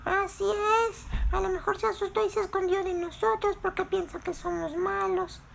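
very high squeaky insect voice; strange insect character. Acted. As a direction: explain in a tiny, odd, high voice. Voz de Berilio. (vocecita extraña y aguda de insecto)

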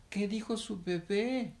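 high-pitched baffled heron voice; uncomprehending question. Acted. Heron asks what the baby said, baffled. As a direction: ask high, not understanding a thing. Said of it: "porque no entendía".

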